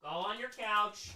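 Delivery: usual speaking voice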